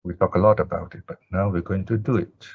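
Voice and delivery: usual speaking voice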